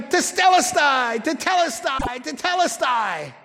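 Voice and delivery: stretched-out triumphant cheer, acted; mock victory shout. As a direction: imitate a crowd celebrating a win. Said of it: "You'd been shouting".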